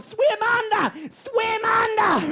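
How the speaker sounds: very high, drawn-out warning shout; long mock Australian holler. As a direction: imitate Australians yelling a warning across water. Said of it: "these Australians are going".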